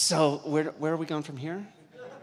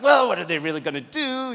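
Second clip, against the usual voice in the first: breezy dismissive; 6 semitones higher, 10 dB louder than usual. Retold or imitated. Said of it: "And I was like".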